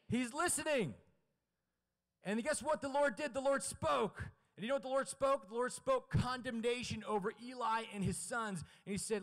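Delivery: high, loud, emphatic proclamation; fired-up sermon delivery; full-force preaching. Real, not acted. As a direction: preach it out to rouse the room.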